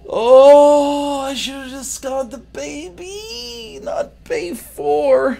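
high slow mock cry; hammed-up sobbing; mock wailing. Streamer mock-wails over a game mistake.

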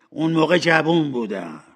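irritated excuse; impatient. Acted. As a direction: brush the reproach off impatiently.

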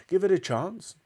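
high, mildly exasperated plea; protesting cry. A narrator's reading. Mister Cranky answering his doubting wife, high protesting cry. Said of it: "cried Mister Cranky".

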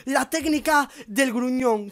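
normal talking voice

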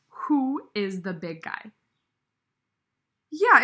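ordinary delivery, nothing marked

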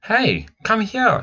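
put-on a boy calling his sister from a distance; yelled. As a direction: call her over from across the junkyard. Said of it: "he yelled".

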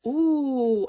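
loud, drawn-out ooh; delighted ooh. Real, not acted. Vlogger spotting a card they love, delighted. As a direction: coo loudly over a find.

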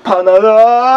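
slow, drawn-out tearful wail; wailing cry. Acted. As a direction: break down crying on the long last syllable. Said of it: "왜 또 울어?". (길게 늘이며 울음 터뜨리기)

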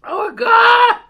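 high, loud pained cry. Real, not acted. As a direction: cry out from the burn. Mouth burning from hot nut.

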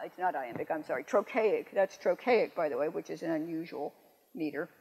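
ordinary talk, nothing marked

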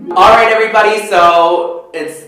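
loud, pumped up for the audience; rally the crowd before playing; hyped announcement. Real, not acted. Addresses audience before performance.

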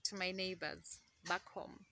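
normal talking voice

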